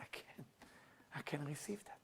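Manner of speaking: very soft, imitating a tearful man; retold tearfulness. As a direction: quietly act out being unable to accept it. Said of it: "And he start crying".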